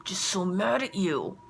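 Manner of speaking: slow, mock-huffy kid voice; pouty, exaggerated kid grumble; child's mad huff. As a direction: imitate a cross little boy with a drawn-out ugh. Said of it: "and he goes".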